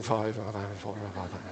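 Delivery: low, mumbled numbers under the breath; mumbling. Retold or imitated. Audience mumbling the rule back, low and unsure. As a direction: mumble the answer half to yourself. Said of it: "And people mumbling".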